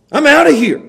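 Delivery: high, loud, I'm done; fed-up quitter. Acted. Preacher voicing Timothy, loud fed-up quit. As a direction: blurt out the exit.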